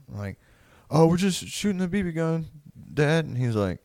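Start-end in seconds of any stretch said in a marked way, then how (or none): 0.9-3.3 high, small-voiced imitation of a kid; higher-pitched, sheepish mock excuse; caught kid. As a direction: play a kid meekly explaining himself to dad. Caught kid answering his dad.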